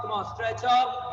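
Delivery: ordinary delivery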